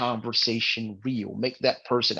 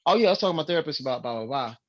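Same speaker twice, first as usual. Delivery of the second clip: quick, offhand aside about therapy; breezy and rapid-fire, like no big deal; casual mention; 3 semitones higher, much faster than usual. Retold or imitated. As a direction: rattle it off casually, trailing into filler. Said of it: "I've mentioned just casually, like".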